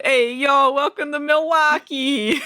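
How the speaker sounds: hammed-up Jersey accent; mock Jersey accent. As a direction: tease the show's Jersey accent with a grin. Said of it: "but it is kind of funny. Like".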